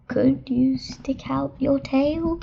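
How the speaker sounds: nervous whisper. Acted. Nervous child whispering to the monster under the bed. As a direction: breathe the question nervously. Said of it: "I whispered".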